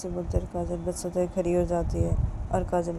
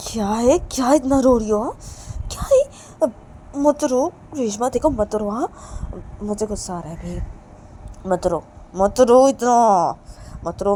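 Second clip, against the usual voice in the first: loud, exasperated telling-off, rising higher; angry scolding; 4 semitones higher, 9 dB louder than usual. Acted. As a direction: order her to stop crying, getting louder. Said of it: "काजल ये बोल के बहुत ज़्यादा गुस्सा करने लगती है".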